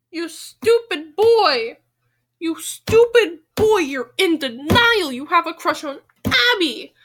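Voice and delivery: loud, slow mock scolding; scolding a character. Real, not acted. Reader scolds Quentin for his denial. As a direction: drag out each word like a fed-up scold.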